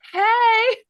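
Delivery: high, loud playful call across the stands; hollering across the bleachers. Retold or imitated. Calling to the fan with the beach ball, high and loud.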